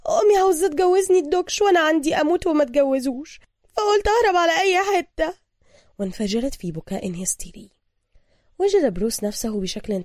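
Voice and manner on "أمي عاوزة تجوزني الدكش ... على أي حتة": high, loud, agitated female voice; breathless, hurried woman. A narrator's reading. Woman fleeing a forced marriage, hurried and agitated. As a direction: blurt it out high and urgent. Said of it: "تلاحقت أنفاس ابتسام وهي تتكلم بسرعة".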